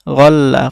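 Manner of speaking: গল্লা with the l doubled and held; doubled consonant. Shown as a demonstration. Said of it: "সে হরফটি দুইবার করে উচ্চারণ হইতেছে". (তাজদিদে লাম দুইবার উচ্চারণ)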